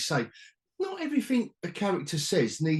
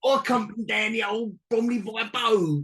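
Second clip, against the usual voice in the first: higher-pitched Cockney orc swagger; Cockney accent; 7 semitones higher than usual. Retold or imitated. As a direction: play it as a cheeky Cockney orc. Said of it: "Cockney swagger".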